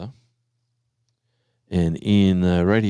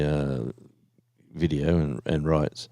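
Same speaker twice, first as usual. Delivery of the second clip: normal talking voice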